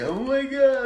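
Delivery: high, loud mock panic; freaking out. Frightened kid on the Haunted Mansion, freaking out. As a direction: imitate a kid losing it. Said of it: "the kid freaks the fuck out".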